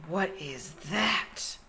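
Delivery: high gasp, then a slow drawn-out question; startled little jellyfish; gasp then slow wonder. Acted. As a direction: sound amazed by something huge. Jerry gasps at something big.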